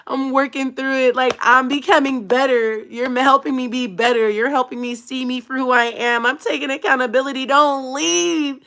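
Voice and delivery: high, whiny begging plea; pleading. Retold or imitated. Begging her man to stay, high pleading. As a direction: beg him not to go, hammed up. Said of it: "I'm like".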